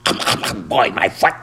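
barked dog voice; scoffing terrier, breaking into words from yaps; yelping terrier. A narrator's reading. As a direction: yap it out like a small dog. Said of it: "yelped the terrier".

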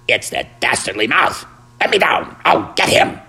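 excited, climbing dog voice; yelping terrier. A narrator's reading. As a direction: yap furiously to be let loose. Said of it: "yelped the terrier".

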